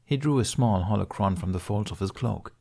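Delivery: ordinary delivery, nothing marked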